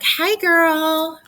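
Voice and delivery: high, loud bubbly squeal of a friend; gushy girlfriend greeting. Retold or imitated. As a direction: gush like texting your best friend. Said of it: "don't just put 'em all over the place like".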